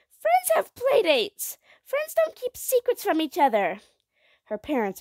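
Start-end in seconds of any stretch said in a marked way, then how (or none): 0.1-3.8 high, loud, wailing protest; high-pitched impassioned child voice; upset child. A narrator's reading. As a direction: protest like a distressed little girl. Child argues with mother.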